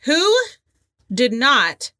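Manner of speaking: ordinary delivery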